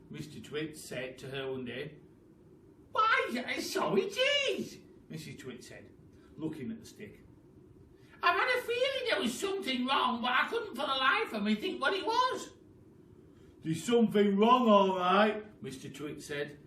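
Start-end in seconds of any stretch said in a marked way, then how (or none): 2.9-4.8 put-on very high old woman's voice; surprised Mrs Twit. Wife surprised at her stick, high crone voice. 8.2-12.5 put-on very high old woman's voice; surprised Mrs Twit. Wife surprised at her stick, high crone voice. 13.6-15.4 loud smug reply; enjoy the joke; gloating Mr Twit. Acted. Husband smugly gloating to his wife, loud. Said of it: "beginning to enjoy himself".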